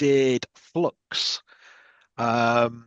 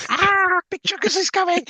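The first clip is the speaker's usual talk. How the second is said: high-pitched mock scream; Toodle screaming; 3 dB louder than usual. Imitating a teammate heard across the game, mock screaming. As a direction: shriek an alarm in imitation. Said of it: "like, Toodle screaming".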